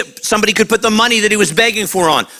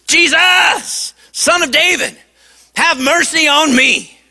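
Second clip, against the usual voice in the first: high, raised plea for mercy; pleading cry; 6 semitones higher than usual. Retold or imitated. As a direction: call out to Jesus for mercy. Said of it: "he shouted all the more".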